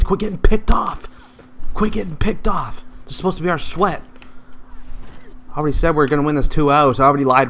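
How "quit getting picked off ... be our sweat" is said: high, pleading complaint; scolding. Real, not acted. Gamer scolding his teammates for dying. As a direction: whine at the team.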